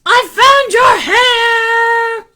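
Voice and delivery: high, stretched-out holler; mother's yell. Acted. Mother finding hair on baby, yelling to son. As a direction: call it out long and high. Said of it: "she yelled".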